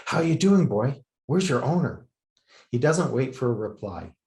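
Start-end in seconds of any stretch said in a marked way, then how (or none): 0.0-2.0 put-on higher, louder, cooing at a small child; playful baby talk. A narrator's reading. Journalist greeting the dog, playful and higher. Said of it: "he says in a playful voice".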